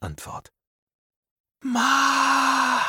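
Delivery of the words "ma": very high, long-drawn cry; desperate cry. Acted. Wounded captive in the pit crying out for his mother, desperate. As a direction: call out desperately for mother, holding the vowel.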